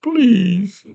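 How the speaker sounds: put-on pleading character voice; Hoggle. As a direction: beg forgiveness, rising high on the last plea. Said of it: "Hoggle's like".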